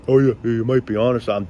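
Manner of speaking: quick, offhand imitation of the couple; tossing off the friend's lazy agreement; mock friend. Mimicking the couple's reply.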